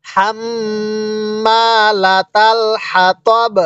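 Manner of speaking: long hummed double m in হাম্মালাতাল; slow tajweed reading. Shown as a demonstration. Teacher showing how to read with a stop, long nasal hum in হাম্মালাতাল. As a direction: stretch হাম্মালাতাল on a held nasal m, stop crisply on হাতব. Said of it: "তাহলে কিভাবে পড়ব".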